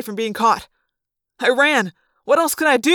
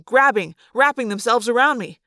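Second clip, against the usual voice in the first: ordinary delivery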